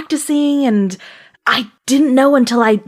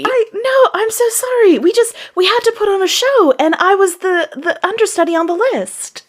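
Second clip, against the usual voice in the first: nervous apology; 8 semitones higher than usual. Acted. Understudy apologising to angry diva.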